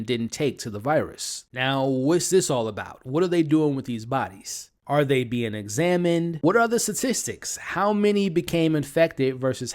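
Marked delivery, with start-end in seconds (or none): none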